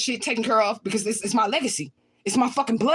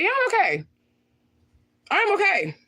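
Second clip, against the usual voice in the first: exasperated insistence; 11 semitones higher than usual. Real, not acted. Answering a viewer who asks if she's okay, exasperated. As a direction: insist with pitch shooting up.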